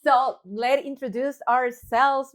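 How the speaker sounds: grand show opener; announcer intro. Acted. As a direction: sell the show title like a radio host.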